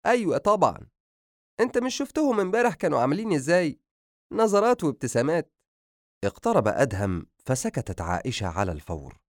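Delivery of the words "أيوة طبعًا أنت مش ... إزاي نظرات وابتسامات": high, eager motherly chatter; delighted mother sharing what she saw, pitched high; excited gossiping mother. A narrator's reading. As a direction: gossip happily in a lifted voice. Mother gossips about the young couple.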